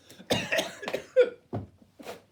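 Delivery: loud choking coughs; hacking on crumbs; coughing fit. Real, not acted. Chokes on biscuit crumbs.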